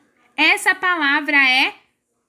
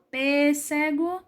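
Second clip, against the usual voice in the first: pêssego slowed into pê-sse-go, rising at the end; syllable by syllable; slower than usual. Shown as a demonstration. Teacher separating syllables for pupils, slow pê-sse-go. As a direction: separate the parts of pêssego evenly. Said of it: "Quando vocês forem separar a sílaba".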